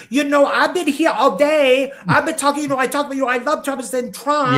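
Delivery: loud, high-pitched mimicry of her speech; mocking celebrity impression. Co-host showing how the rapper talks, high mocking impression. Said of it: "She's funny to listen to because she does that".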